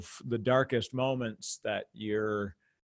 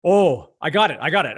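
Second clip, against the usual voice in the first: excited realization; 4 semitones higher, 8 dB louder than usual. Retold or imitated. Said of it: "you're like".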